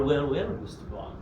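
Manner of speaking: Bond villain. Acted. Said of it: "That was excellent".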